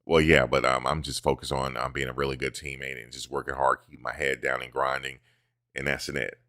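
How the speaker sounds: soft, low, rehearsed player answer; mock Travis answer. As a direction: mumble the clichés like a bland athlete. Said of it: "here's his answer".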